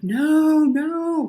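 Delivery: high, loud pleading no; mock sobbing protest; father crying on the sidelines, pleading no; crying plea. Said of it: "like, crying".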